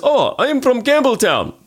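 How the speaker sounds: slow, high mock Russian accent; hammed-up Russian accent, high and slow. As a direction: play the punchline in a thick Russian accent. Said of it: "And he goes".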